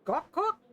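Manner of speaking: high gawking honk noise; imitated robot squawk.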